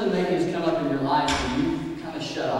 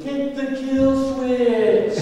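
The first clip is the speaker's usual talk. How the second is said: high, very slow, drawn-out yell; dad shouting from the side; slow, higher shouted command; yelling instruction; 9 semitones higher, 3 dB louder, much slower than usual. Retold or imitated. Dad yelling while jumping aside.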